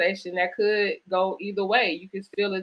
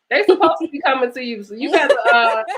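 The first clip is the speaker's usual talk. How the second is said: loud, high teasing with laughter; playful laughing tease; 8 semitones higher, 7 dB louder than usual. Real, not acted. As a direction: joke with her, laughing. Teasing about phone line.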